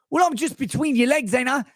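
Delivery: high-pitched mock British man; British accent. British client answers the prostitute mid-act, mock British accent. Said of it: "he talks the same because they're British".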